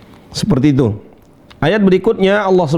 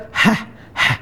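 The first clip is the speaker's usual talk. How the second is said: unvoiced throaty "hah" puffs, as after chilli; spicy-mouth panting; 5 semitones higher, 4 dB quieter than usual. Shown as a demonstration. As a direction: puff out air from a burning mouth. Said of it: "Gimana kalau pedas?". (desah "hah" seperti kepedesan)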